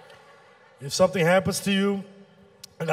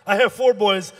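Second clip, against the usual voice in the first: ordinary talk, nothing marked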